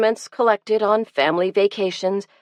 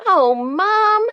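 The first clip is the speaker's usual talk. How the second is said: groaning; 13 semitones higher, 3 dB louder than usual. A narrator's reading. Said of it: "groaned Elizabeth".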